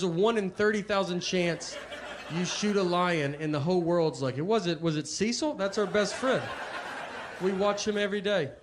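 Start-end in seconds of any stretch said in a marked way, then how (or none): 4.4-8.5 higher-pitched outraged public; mock dismay. Whole world reacting to the shot lion, mock dismayed. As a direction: plead on behalf of a beloved friend.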